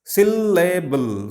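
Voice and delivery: "Syllable" slowly in three beats, syl-la-ble. Shown as a demonstration. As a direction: stretch each syllable of "Syllable". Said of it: "three syllabic words".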